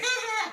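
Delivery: very high mock shriek like the Simpsons character; cartoon scream. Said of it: "pone el grito". (grito agudo de caricatura)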